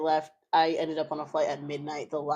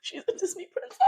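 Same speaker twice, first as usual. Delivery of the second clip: much higher dreamy voice, lightly played; mock swooning; 13 semitones higher, 5 dB quieter than usual. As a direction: gush like a starstruck fan. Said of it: "And I was like".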